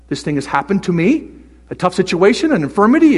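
usual speaking voice

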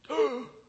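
sharp high delighted gasp; little girl's gasp of delight; excited gasp. Retold or imitated. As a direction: gasp like a thrilled toddler. Toddler gasps at dancing.

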